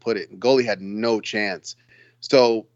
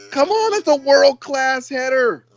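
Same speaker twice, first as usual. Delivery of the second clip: laughing; 14 semitones higher, 4 dB louder than usual. Real, not acted. Laughing insistence over a header.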